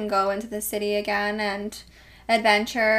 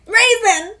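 excited squeal; 10 semitones higher, 6 dB louder than usual. Real, not acted. Squealing at a cute dog name.

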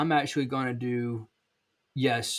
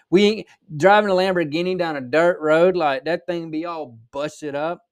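thicker Southern accent, higher pitched; Southern drawl; 5 semitones higher, 5 dB louder than usual. Real, not acted. Podcaster joking about Lamborghinis on dirt roads, Southern accent. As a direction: lean into South Georgia talk, animated and high. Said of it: "If you can't hear it in my accent".

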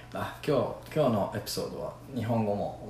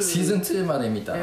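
surprised; 8 semitones higher, 6 dB louder, much faster than usual. Real, not acted. Surprised at how much watched.

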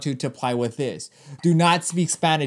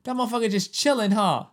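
higher, easy laid-back banter; casual sports dude; 7 semitones higher than usual. Retold or imitated. Host imitates a fan's reply at the office, a lightly voiced laid-back dude. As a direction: toss it off like a bar regular. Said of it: "I'd be like".

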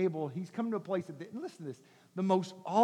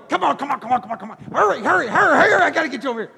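pushy hurrying; 3 semitones higher, 5 dB louder, much faster than usual. Retold or imitated. Preacher acts out someone rushing, rising urgency. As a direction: hurry them along, hammed up.

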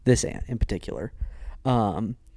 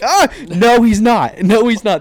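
loud, high-pitched outburst of refusal; panicked denial; 10 semitones higher, 13 dB louder, slower than usual. Retold or imitated. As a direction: retell it as a yelled, horrified objection. Retold panicked denial of date's claim.

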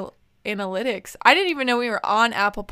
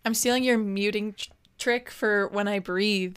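ordinary talk, nothing marked

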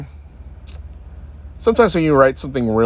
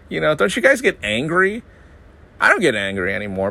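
ordinary talk, nothing marked

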